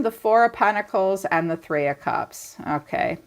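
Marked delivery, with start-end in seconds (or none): none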